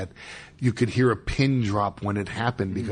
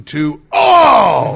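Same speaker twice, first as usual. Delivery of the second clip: crowd gasp; 7 semitones higher, 14 dB louder than usual. Retold or imitated. Crowd near-fall reaction.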